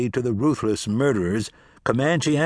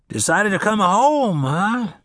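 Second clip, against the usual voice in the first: put-on grumbling old farmer, slow and dry; 9 semitones higher, 3 dB louder, slower than usual. Old farmer answering Cass, wry and grumbling. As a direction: drawl it like a sour old sharecropper.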